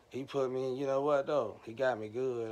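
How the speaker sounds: ordinary talk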